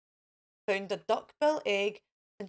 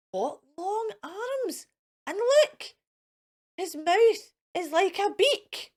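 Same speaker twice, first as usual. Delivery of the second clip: higher, slow, aghast mother voice; hammed-up shocked mum; horrified; 9 semitones higher, slower than usual. A narrator's reading. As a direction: gasp at the odd baby. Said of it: "Mother T was horrified".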